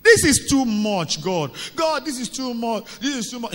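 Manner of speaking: loud, high outcry to heaven; high-pitched, worked-up prayer protest; exasperated plea. Acted. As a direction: cry out in frustration at God. Frustrated outcry to God.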